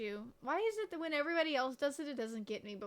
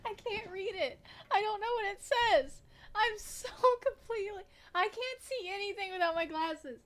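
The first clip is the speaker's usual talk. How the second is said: high-pitched, half-laughing complaint; exasperated protest; 7 semitones higher, 4 dB louder than usual. Real, not acted. Teased, cannot read without glasses.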